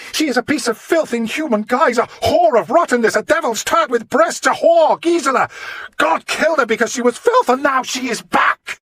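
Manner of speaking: high, loud venomous ranting; a madman's shrieked curse; frenzied screaming. Acted. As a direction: spit the insults out. Monk screaming curses in the hall.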